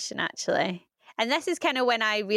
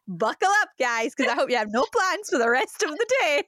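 higher-pitched jokey warning to everyone; playful, raised-pitch hammed-up heads-up; mock announcement; 6 semitones higher than usual. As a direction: announce the coming meltdown as a joke. Mock announcement of a crying spell.

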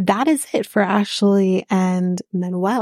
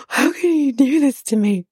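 higher-pitched imitation of someone freaking out; retold freak-out; 6 semitones higher than usual. Recapper imitating Nikki's reaction to the text, mock freak-out. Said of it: "she's just freaking out".

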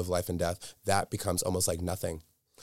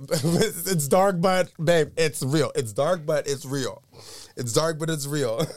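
laughing emphasis; 7 semitones higher, 5 dB louder, slower than usual. Real, not acted. Laughing emphatic repeated phrase.

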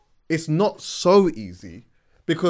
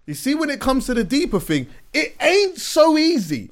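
excited; 8 semitones higher, 6 dB louder than usual. Real, not acted. High excited arguing voice.

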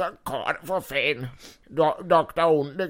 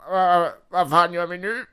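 villain voice; 4 semitones higher, 4 dB louder than usual. Acted.

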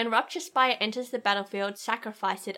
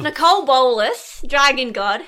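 put-on Aussie accent; 3 semitones higher, 10 dB louder than usual. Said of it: "Sounds so Aussie".

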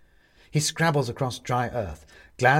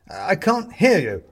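high, loud, slow shout; calling back; 9 semitones higher, 8 dB louder, slower than usual. A narrator's reading. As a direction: call it slowly across a distance. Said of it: "he stops and calls back".

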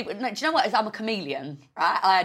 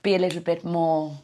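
a lightly done posh English accent, slightly lower; half-hearted posh English accent; put-on posh English accent; 3 semitones lower than usual. Shown as a demonstration. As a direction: show off a mock posh English voice, a little clumsy. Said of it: "So then I put on the posh voice".

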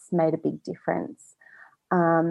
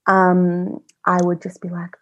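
ordinary talk, nothing marked

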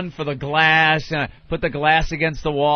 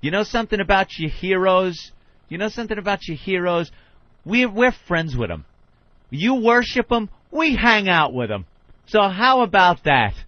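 higher-pitched indignant comeback, building in force; unloading on caller; 7 semitones higher, 3 dB louder than usual. Retold or imitated. Radio host retold putting down a hostile caller, indignant. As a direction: tell him off, punching each retort. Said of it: "Ronnie just unloads on him".

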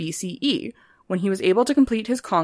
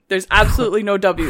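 usual speaking voice

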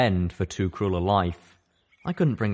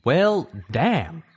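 impressed; 9 semitones higher, 6 dB louder than usual. Acted. Calamity whistles.

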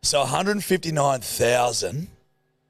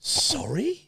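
high incredulous sorry; shocked; 13 semitones higher, 3 dB quieter than usual. Real, not acted. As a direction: squeak it in disbelief. Shocked reaction to quote.

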